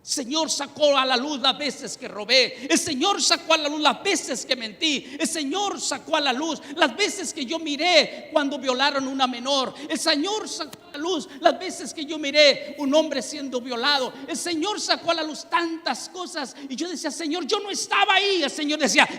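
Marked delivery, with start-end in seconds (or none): 0.0-16.6 a fiery pulpit testimony; fervent preaching. Acted. Testimony preached at full force. 17.1-18.4 much higher anguished denial; begging God in disbelief; desperate plea. Retold or imitated. Pleading to God in vision.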